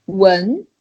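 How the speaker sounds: "wen" sliding upward; climbing "wen", like a question; rising second tone. Shown as a demonstration. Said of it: "Yeah, it's the second one".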